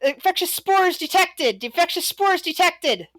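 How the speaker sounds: high-pitched alarm call, repeated urgently; alarm announcement. Acted. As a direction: announce a danger like a guard on alert. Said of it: "uh, say, saying".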